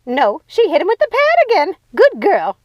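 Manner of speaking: high, loud gleeful cackle-talk; laughing. Acted. Ruby cheering at the mirror, laughing loud and high. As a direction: cheer her on through a laugh. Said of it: "Ruby said, laughing".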